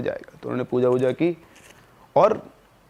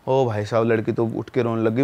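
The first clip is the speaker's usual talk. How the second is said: ordinary delivery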